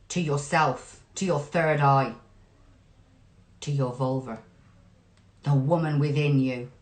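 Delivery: soft, low meditative voice with long pauses; guided meditation. Acted. Reader guiding listeners to connect within, soft meditative voice. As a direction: lead them inward, softly and unhurried.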